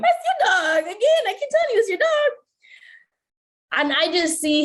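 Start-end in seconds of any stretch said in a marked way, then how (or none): 0.0-2.3 high, pointed mock insistence; exasperated insistence. Insisting to her boyfriend it's his dog, exasperated. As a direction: press the point, fed up.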